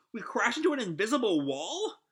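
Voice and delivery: high, swooping incredulous question; sweating nervously. Acted. Happy reacting to the crash, sweating nervous disbelief. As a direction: ask it nervously, pitch climbing at the end. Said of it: "sort of, like, sweating now".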